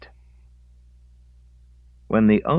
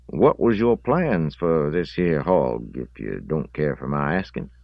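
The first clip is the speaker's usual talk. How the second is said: raised, louder country voice, slowing for the question; contemplative pig owner; 6 semitones higher, 5 dB louder than usual. Acted. Owner sounds out the boy about the dead hog, contemplative country voice. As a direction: play the owner politely sizing up the boy.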